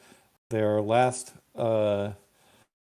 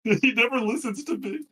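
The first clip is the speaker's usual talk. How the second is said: high put-on voice; 14 semitones higher, 4 dB louder than usual. NPC complaint in put-on voice.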